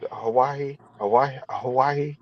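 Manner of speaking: "Hawaii" repeated softly, trying out the sound; soft, tentative tries at a local "Hawaii"; attempted local pronunciation. Shown as a demonstration. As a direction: practise saying "Hawaii" like a local, unsure. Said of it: "I can't say it like they say it".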